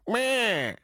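high, drawn-out mocking "meh"; dismissive listener. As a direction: imitate a whining, dismissive texter. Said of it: "gonna text me and go".

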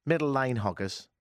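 usual speaking voice

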